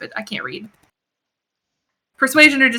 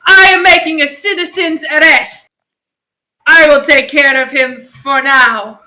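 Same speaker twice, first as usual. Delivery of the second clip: loud, raised announcement to the guards; acted yell; 2 semitones higher, 6 dB louder, slower than usual. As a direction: call it out with put-on authority, drawing out the end. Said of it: "I just yell out to these guards".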